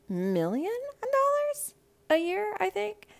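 high, stretched-out squeal of disbelief; shocked disbelief. Real, not acted. To viewers, stunned by the alimony total, high incredulous squeal. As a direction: gasp the sum out high and slow.

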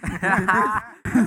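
loud laugh through the word; laughing hard while asking; laughing out loud, slow. Real, not acted. Laughing at own example.

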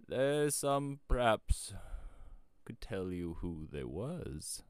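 put-on mysterious rustic old-timer; hint darkly in a country voice; old shepherd. A narrator's reading.

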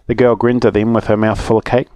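ordinary delivery, nothing marked

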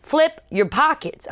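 loud, high, slow order; imitated insistent guard. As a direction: repeat it slowly and forcefully. Guard repeats his order insistently.

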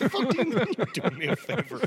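laughing at the organizer's cheek. Real, not acted. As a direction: laugh out the outrage. Laughing outrage at story.

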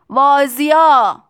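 long drawn-out, high yell; loud shout. A narrator's reading. Factory owner answering Tistu over the noise, drawn-out shout. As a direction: bellow it slowly over the din. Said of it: "آقای ترونادیس فریاد زد".

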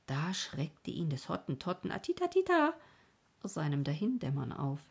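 plain reading voice

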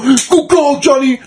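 high-pitched mock congratulation; retold cheer. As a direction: imitate a teammate cheering a goal. Acting out teammates cheering a sub.

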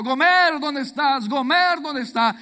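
loud, high calling out across a square; shouting her name. Acted. Hosea searching the plazas, shouting for his wife Gomer. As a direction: call out desperately for someone lost.

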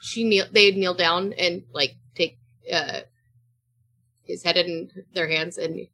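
ordinary talk

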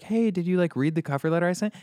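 high, fast mock mother nagging; mock mom voice. Son mimicking his mom calling from the doorway, high and fast. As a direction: imitate his mom nagging him sweetly. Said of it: "he keeps putting her on mute".

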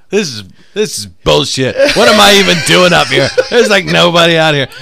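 loud mock rant at the crowd; yelling comic. Comic yelling at an empty crowd, loud rant. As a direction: shout it like an angry comic on stage. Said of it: "he come out and he was like".

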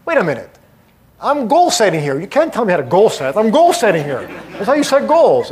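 indignant surprise. Retold or imitated. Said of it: "I go".

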